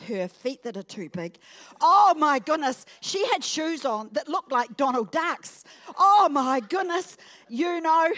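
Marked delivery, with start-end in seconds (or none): 1.8-8.1 mock gossip. Acting out gossip about a woman.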